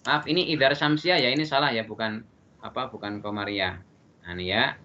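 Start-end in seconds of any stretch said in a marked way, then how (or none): none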